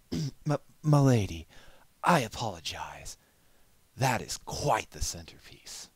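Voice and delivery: regain composure awkwardly; flustered gentleman. Acted. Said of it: "he trailed off".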